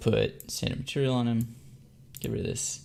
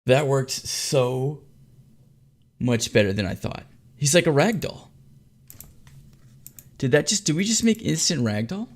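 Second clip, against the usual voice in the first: excited; 3 semitones higher, 3 dB louder than usual. Real, not acted. Amazed by rag doll simulation.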